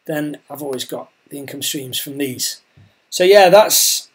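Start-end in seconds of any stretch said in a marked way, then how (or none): 0.1-2.6 talking while losing your voice; hoarse voice. Real, not acted. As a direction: voice going hoarse, strained. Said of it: "I'm losing my voice".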